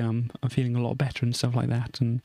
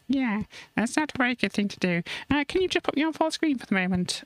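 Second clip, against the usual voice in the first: high, chirpy puppet friend voice; a cheeky little sidekick asking for attention, pitched up; Tracy's voice; 12 semitones higher than usual. Acted. Puppet Tracy asks the host for full screen, high and playful.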